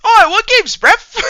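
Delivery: piercing cartoon British squeal; extremely high register. Showing off the cartoon voice, extremely high mock British. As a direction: push it way up, loud and squeaky. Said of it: "I can hit that register".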